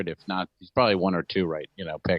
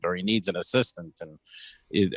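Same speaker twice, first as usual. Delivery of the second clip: ordinary talk, nothing marked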